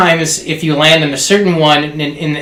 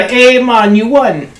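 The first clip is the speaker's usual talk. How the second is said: put-on a higher-pitched Bahamian accent for the lady; 7 semitones higher, much slower than usual. Bahamian lady at the casino announces his win, higher Bahamian accent. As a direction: play the Bahamian casino lady telling him he won. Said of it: "Is that exaggeration, or is that how she really talks".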